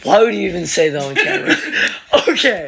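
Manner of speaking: laughing through words. Real, not acted. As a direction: giggle while protesting. Laughing at dad's joke.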